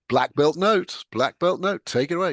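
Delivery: normal talking voice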